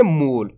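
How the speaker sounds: "मूल" read with a stretched oo; long drawn vowel. Shown as a demonstration. Teacher repeating the correct reading for learners, long drawn vowel. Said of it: "केच के मूल".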